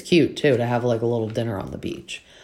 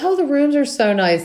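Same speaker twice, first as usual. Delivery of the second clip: high, gushing delight; 14 semitones higher, 5 dB louder than usual. Retold or imitated. Cast members arriving, gushing at their rooms in a high voice. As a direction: squeal over the nice rooms.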